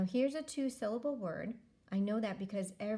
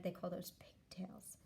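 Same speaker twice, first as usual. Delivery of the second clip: soft confiding aside, much quieter; whisper; 8 dB quieter than usual. Shown as a demonstration. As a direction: whisper it like a little secret. Whispered aside explaining word.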